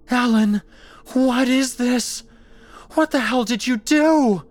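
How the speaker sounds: high, loud, distraught yell; shrieked demand for an explanation; horrified screaming. Acted. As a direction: scream in disbelief at your friend. Screaming at her monstrous friend.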